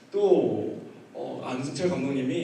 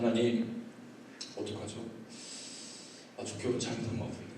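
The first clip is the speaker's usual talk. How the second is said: low, subdued reenacted prayer; helpless prayer; 4 semitones lower, 9 dB quieter than usual. Pastor prays under pulpit, quietly helpless. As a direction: quietly confide in God, at a loss.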